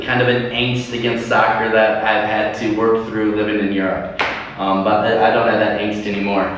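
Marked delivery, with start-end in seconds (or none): none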